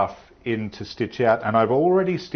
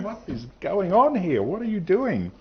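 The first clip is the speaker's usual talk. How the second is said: puzzled; 8 semitones higher than usual. Retold or imitated. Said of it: "you're all going".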